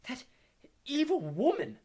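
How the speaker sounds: high, loud, choked with rage; enraged gasp. A narrator's reading. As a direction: struggle to get it out in fury. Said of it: "gasped Hermione".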